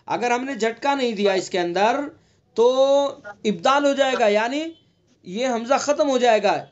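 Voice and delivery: usual speaking voice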